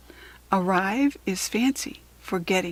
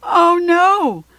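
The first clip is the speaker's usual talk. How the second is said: dismayed surprise; 8 semitones higher, 7 dB louder than usual. Acted. Tooth falls out from sneeze.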